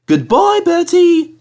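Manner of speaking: high, loud cheeky call. Acted. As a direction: call out goodbye, triumphant. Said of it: "called Thomas".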